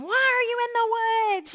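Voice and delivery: retold panicky interrogation; frantic demand. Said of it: "starts terrorizing them".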